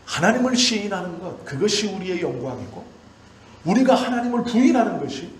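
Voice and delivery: high, loud, impassioned pulpit cry, pressing each clause; preaching at full force. Acted. Pastor to his congregation on shame and glory, fervent full-force preaching. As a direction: preach it out to the back row.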